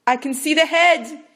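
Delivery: loud, high exclamation; excited exclaim. Acted. Midwife announcing the head, excited. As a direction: burst out with the news. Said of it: "Yima exclaimed".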